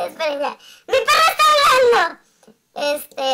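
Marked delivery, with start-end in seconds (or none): 0.9-2.0 high, loud, fast laughing shout; shouted punchline. Acted. Son shouting at mother, high laughing yell.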